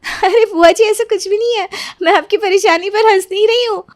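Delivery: high, urgent denial; pleading protest. Acted. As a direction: beg her to believe you. Niece pleads her innocence.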